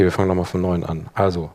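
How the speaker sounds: normal talking voice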